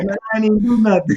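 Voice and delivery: put-on high, fast protesting kid voice; whiny kid. To the teacher, a kid whining his innocence. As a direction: play the kid denying everything, fast and high. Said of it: "heard a kid say".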